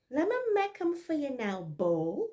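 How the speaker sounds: abrupt Barbados speech; Bajan accent. Maid abruptly offering breakfast in Bajan dialect. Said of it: "this is Bajan dialect".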